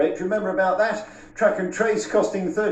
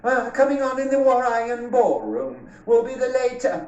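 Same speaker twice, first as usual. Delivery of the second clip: hammed-up Birmingham camp announcer; a light Brummie compère welcoming the guests; Birmingham accent; 6 semitones higher than usual. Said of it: "This is my Birmingham accent".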